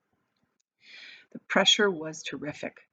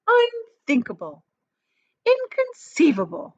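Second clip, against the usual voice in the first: theatrical dismissal; 11 semitones higher, 4 dB louder than usual. A narrator's reading. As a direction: dismiss it with showy flair. Wonka dismissing the fear.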